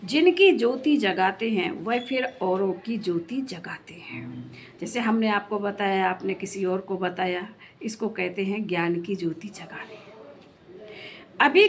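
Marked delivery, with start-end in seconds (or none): 1.7-4.5 whispered, फिर lightly stressed; confiding whisper to the congregation. Acted. Preacher drops to a whisper. 7.8-10.0 whispered, फिर lightly stressed; confiding whisper to the congregation. Acted. Preacher drops to a whisper.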